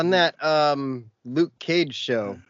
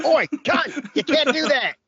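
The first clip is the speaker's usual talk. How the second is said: high, hammed-up Aussie scolding; mock Australian; 12 semitones higher than usual. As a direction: play a rowdy Australian telling someone off.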